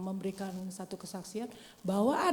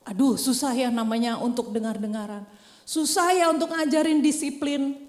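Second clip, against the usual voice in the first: louder, higher preaching lament; 3 semitones higher, 8 dB louder than usual. Acted. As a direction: push it out louder. Same lament repeated louder. (keluhan lebih keras dan tinggi)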